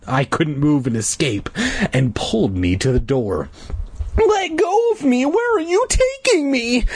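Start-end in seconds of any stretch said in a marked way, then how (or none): 4.2-6.8 high, alarmed protest; frantic demand. A narrator's reading. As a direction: demand release in a high cry. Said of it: "I demanded".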